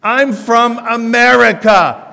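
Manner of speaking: loud, slow, high hammed-up American accent; exaggerated American accent. Shown as a demonstration. Preacher showing the congregation an American accent, loud and slow. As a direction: drawl out a broad American accent to the booth. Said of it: "listen to this accent".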